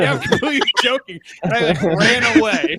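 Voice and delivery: laughing through a high, loud line. Retold or imitated. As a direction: crack up while owning the joke. Retold bluff, laughing at the end.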